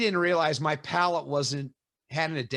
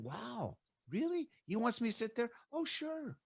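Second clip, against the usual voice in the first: high, hushed mock amazement, eyes wide; playing the eager newcomer who can't believe his luck; naive excitement; 8 semitones higher, 9 dB quieter than usual. Novice told to sit by Parker.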